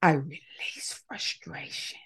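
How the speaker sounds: slow, breathy whisper of each phrase; whispered affirmation. Shown as a demonstration. Leader whispering affirmations for the group to repeat. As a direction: hush it as a calming model for the listeners. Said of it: "Let's one more time".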